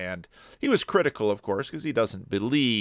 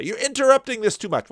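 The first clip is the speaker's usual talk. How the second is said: high, quick mock complaint; imitating complaining viewers; 11 semitones higher, 3 dB louder, faster than usual. Host voicing viewers who dislike his interruptions, high mock complaint. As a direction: pitch it up and rush it like an objecting viewer. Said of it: "some of you are gonna say".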